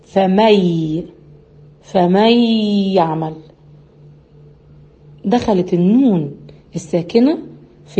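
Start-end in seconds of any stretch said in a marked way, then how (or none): 0.0-3.3 فمي said slowly with the nun merged into a held nasal mim. Shown as a demonstration. Teacher demonstrating idgham to students, slow nasal hum. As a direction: stretch فمي يعمل with a long humming ghunna. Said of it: "فبقولها إيه؟".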